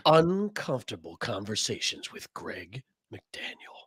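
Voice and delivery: slow, low, soft announcer intro; show announcer voice. Pretend. Cohost announcing the show title, in a slow announcer voice. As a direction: introduce the segment like a TV host, slowly. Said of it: "let me just go like this".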